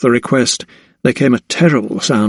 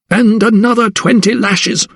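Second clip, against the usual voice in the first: higher, vicious snarl; 10 semitones higher than usual. A narrator's reading. Mistress sentencing the girl, snarling. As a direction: hand down the punishment with venom. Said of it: "she snarled".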